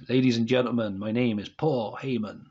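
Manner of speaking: ordinary talk, nothing marked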